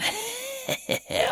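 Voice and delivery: high villainous cackle; evil cackle. Retold or imitated. Podcaster reading a mug thief's confession, gleeful cackle. Evil laugh after claiming cups.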